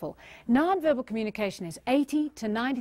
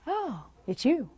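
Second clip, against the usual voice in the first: cooler greeting; 3 semitones higher, slower than usual. Shown as a demonstration. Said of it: "A few years later".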